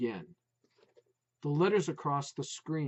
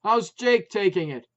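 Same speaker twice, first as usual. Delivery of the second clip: louder, higher; raised concern; 5 semitones higher, 10 dB louder than usual. A narrator's reading. Putz asking about Jake over barking, raised voice. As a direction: ask it over the noise. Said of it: "voiced his real concern".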